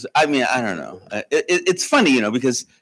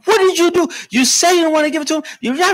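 mother's mock anger; faster than usual. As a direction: play the exasperated wife, pitched up. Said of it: "my mother's mad".

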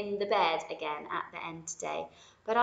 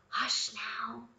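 soft whispered hush now; 3 semitones higher, 3 dB quieter than usual. Acted. Mother Rabbit whispers hush now to Lily. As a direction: whisper it to a sleepy child. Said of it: "said Mother Rabbit".

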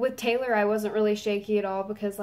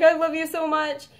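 high, louder declaration of love; excited, pitched-up quote; retold fan gushing; 7 semitones higher, 5 dB louder than usual. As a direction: gush it at your idol. Retold gushing to her idol.

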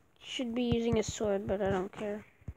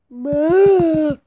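loud boo; 3 semitones higher, 13 dB louder than usual. Pretend. Jump-scaring a zombie villager.

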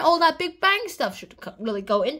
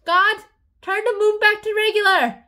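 higher and louder call; mock loud command; 6 semitones higher, 6 dB louder than usual. Columbus calling God, mock loud command. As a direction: cry out to God. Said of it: "they're all like".

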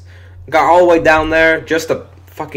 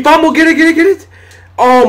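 much higher, louder frantic shout; excited yell; 7 dB louder than usual. Real, not acted. Gamer yelling at his defense for the loose ball, frantic shout. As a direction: scream for the ball like it matters. Fumble scramble, yelling at screen.

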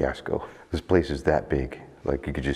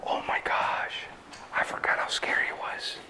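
whispering; 8 dB quieter than usual. Real, not acted. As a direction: breathe it out, spooked. Creeping through scary hospital.

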